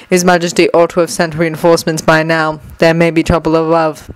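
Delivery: grind it out like a machine; mechanical guard voice. Acted. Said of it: "grated in the same mechanical voice".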